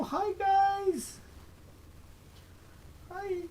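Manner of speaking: put-on squeaky voice speaking for the baby. Dad voicing his baby to say hi. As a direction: greet them as the baby.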